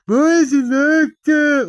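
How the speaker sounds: loud, high, drawn-out wail; mock anguished wail. Complaining as a frustrated human, mock anguished wail. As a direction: wail it in hammed-up despair.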